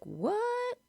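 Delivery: high-pitched mock disbelief; a baffled, high "what?"; incredulous. Woman baffled by the stranger's remark, incredulous. Acting out her baffled reply.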